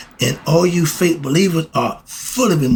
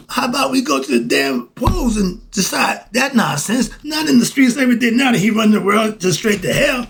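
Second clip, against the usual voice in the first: getting carried away, voice climbing; heated rant that boils over into shouting; worked up; 7 semitones higher than usual. Real, not acted. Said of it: "I'm a little excited about that".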